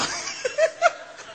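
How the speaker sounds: high breathy laugh. Real, not acted. Preacher laughs.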